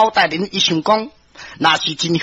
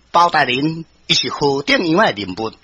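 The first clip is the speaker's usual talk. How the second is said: plain reading voice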